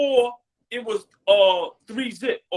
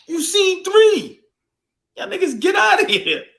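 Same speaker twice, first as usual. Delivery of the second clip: mocking shout; 7 semitones higher than usual. Real, not acted. Mocking rival fans on live.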